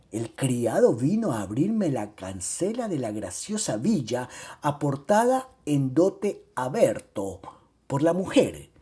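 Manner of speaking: plain reading voice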